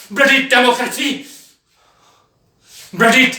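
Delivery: loud, high burst of contempt; explosive, scornful yell; bitter shout. Acted. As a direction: hurl it out in furious disgust. Bitter climax of the tirade.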